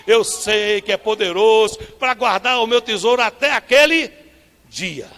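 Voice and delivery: loud, triumphant proclamation; bold declaration. Acted. Believer answering the enemy with a loud proclamation.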